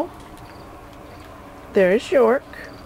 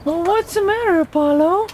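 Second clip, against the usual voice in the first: talking to a dog; 10 semitones higher than usual. Real, not acted. Owner talking to her dog.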